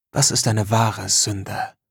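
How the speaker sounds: ordinary delivery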